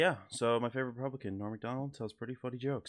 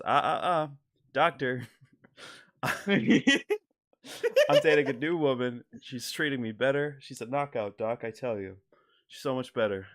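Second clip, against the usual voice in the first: excited Norm cadence; 3 semitones higher, 7 dB louder, slower than usual. Retold or imitated. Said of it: "talking like he's so excited to be telling you this story".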